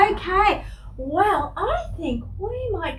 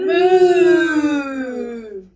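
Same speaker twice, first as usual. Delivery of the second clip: louder, shouted moo; 11 dB louder than usual. Acted. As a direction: moo out loud like a cow. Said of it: "that was a bit quiet".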